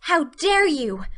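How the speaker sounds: slow, high, loud indignation; passionately affronted outburst; passionate exclamation. A narrator's reading. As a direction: draw out each word in outrage. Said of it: "she exclaimed passionately".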